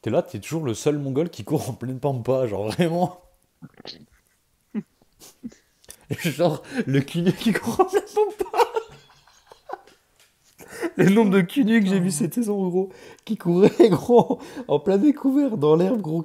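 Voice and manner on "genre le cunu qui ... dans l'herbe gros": higher and louder, laughing through words. Real, not acted. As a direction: crack up while telling it. Cracking up telling funny story.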